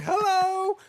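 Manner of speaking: very high, cry-like "Hello."; mock greeting. Voicing the exposed outfit, high crying "Hello". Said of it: "It's like".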